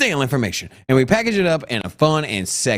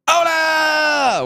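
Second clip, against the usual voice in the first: excited shout; 11 semitones higher than usual. Acted. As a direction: belt it like a hype man. Repeated show greeting.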